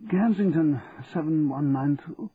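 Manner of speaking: drowsy, slow phone answer; just woken, speak slowly; sleepy voice. A narrator's reading. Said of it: "heard a sleepy voice say".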